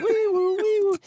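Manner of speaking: high, loud wee-woo siren; siren imitation. [name] mimicking the meat wagon siren, high and loud. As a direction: wail like an ambulance. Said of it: "imitates the siren".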